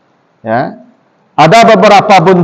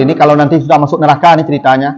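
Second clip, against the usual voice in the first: quick aside; 5 semitones lower, faster than usual. Real, not acted. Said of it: "ini ... ceritanya".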